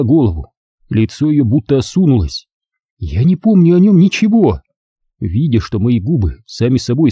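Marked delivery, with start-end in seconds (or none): 3.0-4.6 tragic heroine sorrow. Acted. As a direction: cry out that she remembers nothing. Leonora's outburst.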